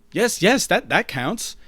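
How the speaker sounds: unconvincing reassurance. Acted. Owner hastily reassures the fatherless robot, loud and unconvincing. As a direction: blurt a hasty fake reassurance. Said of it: "you won't be very convincing".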